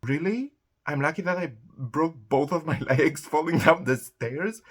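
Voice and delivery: loud, high indignant disbelief; loud laughing outrage; incredulous. Real, not acted.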